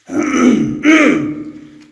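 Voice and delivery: loud, high, rasping throat clearing; choked throat clearing. Real, not acted. Investigator gets choked up mid-question and clears his throat. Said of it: "getting choked up here".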